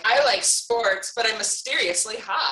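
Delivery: put-on cool girl voice. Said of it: "Like the classic cool girl".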